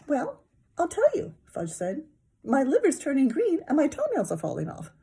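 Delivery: high puppet voice; Muppet voice.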